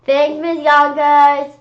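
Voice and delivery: drawn-out chorus thank you; kids in chorus. Acted. Kids thanking Miss Yonkers together, slow and loud. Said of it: "we all said".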